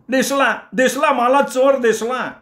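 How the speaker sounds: high, slow excited yell; shout from below. Acted. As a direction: call out spotting the thief. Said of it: "खालून कोणीतरी ओरडलं". (खालून ओरडलेली आरोळी)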